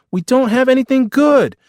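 very high, loud wail of complaint; whining child. Acted. Boy complaining to brother about the fridge, whining. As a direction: whine at full pitch like a small boy.

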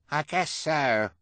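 slow, high put-on voice; fake high voice. A narrator's reading. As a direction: answer in a loud, false high pitch. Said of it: "in his fake high voice".

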